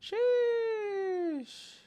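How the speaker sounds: very high, drawn-out falsetto squeal. Pretend. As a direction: hold it long in a piercing falsetto. Meme exclamation in squealed falsetto.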